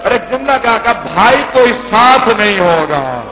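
high, loud grieving cry building to the climax; wailing lament. Acted. Reciter voicing Ali's farewell to Zainab, loud wailing. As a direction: cry it out to stir the mourners.

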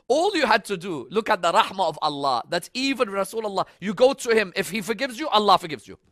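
fervent proclamation; impassioned preaching. Real, not acted. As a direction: drive it home to the congregation, high and loud. Preaches at full force.

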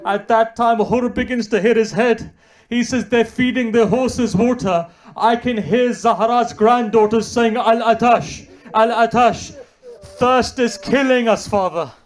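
high, loud lament; impassioned preaching. Acted. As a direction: wail it out to the crowd. Preacher cries out children's thirst.

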